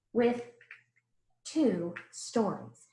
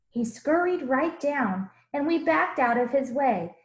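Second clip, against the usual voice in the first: put-on lively rhyming read-aloud for children; storybook reading; 2 semitones higher, 3 dB louder than usual. A narrator's reading. Teacher reading a picture book to the class, animated. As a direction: read the verses with bounce and warmth.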